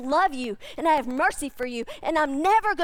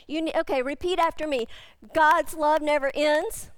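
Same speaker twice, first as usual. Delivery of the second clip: usual speaking voice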